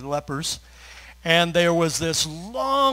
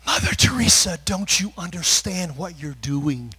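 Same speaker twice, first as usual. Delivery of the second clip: alarmed disciples; 2 semitones higher, 7 dB louder than usual. Retold or imitated. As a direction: voice the horrified followers. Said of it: "and they said".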